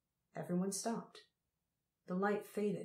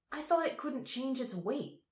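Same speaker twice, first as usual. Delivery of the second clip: aggrieved, pitched higher; complaining; 7 semitones higher than usual. A narrator's reading. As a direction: whine it out a bit. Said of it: "complained Tal".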